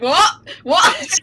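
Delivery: retelling her shock at her full name; imitating startled self. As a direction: act baffled at hearing your full name. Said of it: "I'm just like".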